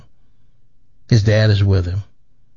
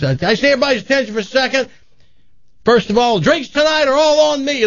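raised, high-pitched announcement to the room; dad's toast; 12 semitones higher, faster than usual. Acted. Dad to his packed tavern of buddies, high rallying toast. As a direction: call for everyone's attention, pitched up.

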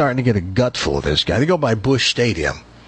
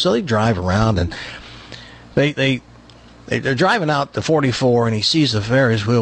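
ordinary talk, nothing marked

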